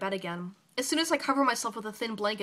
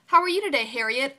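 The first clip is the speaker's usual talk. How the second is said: lightly voiced old man, high and loud; a hint of a chipper old man calling out; old man's greeting; 4 semitones higher, 7 dB louder than usual.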